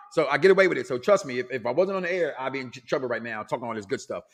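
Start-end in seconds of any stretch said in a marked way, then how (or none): none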